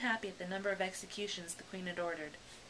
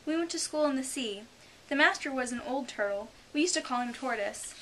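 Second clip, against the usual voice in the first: ordinary delivery, nothing marked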